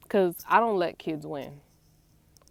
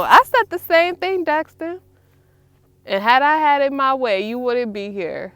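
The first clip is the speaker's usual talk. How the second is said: loud, louder clapback at son; playful retort; 5 semitones higher, 11 dB louder than usual. Real, not acted. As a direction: shoot back at him.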